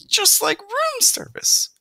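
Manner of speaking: very high, slow, loud wonder; marveling. A narrator's reading. Mother impressed by the sandwich, marveling. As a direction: awestruck mother mouse, drawing it out. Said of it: "she marveled".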